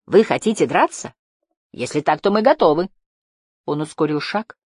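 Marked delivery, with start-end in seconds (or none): 0.0-2.9 higher, raised voice; defiant call; shouted challenge. A narrator's reading. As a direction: throw down a challenge to the enemy. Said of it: "снова крикнул Барда".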